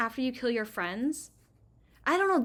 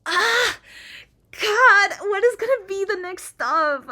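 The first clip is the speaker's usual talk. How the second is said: scared; 8 semitones higher, 8 dB louder, slower than usual. Real, not acted. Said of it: "I'm so scared".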